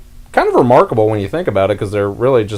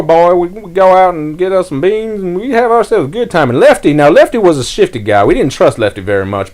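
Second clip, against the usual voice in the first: put-on high, loud, thick Southern accent of an old grandfather; old Southern storyteller; 12 semitones higher, 6 dB louder than usual. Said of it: "really thick Southern accent".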